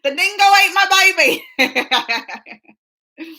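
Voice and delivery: a slow, drawn-out mock Aussie accent; Australian accent. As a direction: lean hard into the Australian vowels, slowly. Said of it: "I've been practicing".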